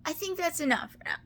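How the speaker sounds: fighting back real tears, laughing through them; choked-up, tearful voice; crying. Real, not acted. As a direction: voice high and breaking with tears. Tearful confession about her husband.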